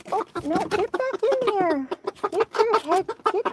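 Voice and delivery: slow, high mock-scold; mock scolding hen. Real, not acted. Owner scolding an escaped hen, slow and high. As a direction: tell the hen off playfully. Scolding chicken back inside.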